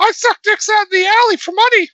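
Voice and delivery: extremely high mock cartoon squeak; squeaky cartoon voice. Co-host playing along with Minnie Mouse joke, squeaky cartoon voice. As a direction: pitch it up absurdly high.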